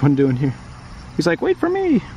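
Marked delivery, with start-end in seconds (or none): 1.4-2.0 very high squeaky little critter; baby animal voice. Acted. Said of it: "I like to make up the voices for the different animals".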